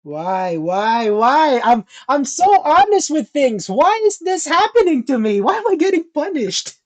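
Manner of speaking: building from plain to loud, high wailing; hammed-up wail of self-pity; anguished lament. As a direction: plead to the heavens, rising in pitch. Acted inner despair, building.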